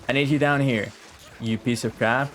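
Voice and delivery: ordinary delivery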